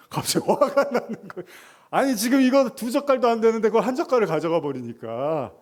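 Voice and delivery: high, loud, laughing indignation; retold anger. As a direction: relive the spaghetti grudge, half laughing. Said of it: "울그락불그락 했어요". (아내가 한 젓갈 가져가자 웃으며 분통 터뜨리듯)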